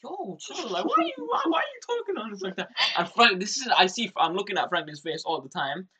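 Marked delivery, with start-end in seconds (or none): 0.0-2.7 indignant protest. Retold or imitated. Teen boy pushing back at pushy girls, high indignant protest. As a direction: squeak out a defensive why are you like this.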